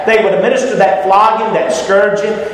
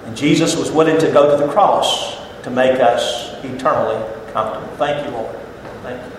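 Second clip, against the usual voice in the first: quiet gratitude; 6 semitones lower, 5 dB quieter than usual. Real, not acted. Tender close in quiet gratitude.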